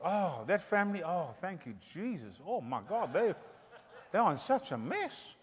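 snickering judgmental gossip; mocking gossip. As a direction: gossip with a smug laugh. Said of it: "pointing fingers at everybody".

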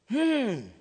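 usual speaking voice

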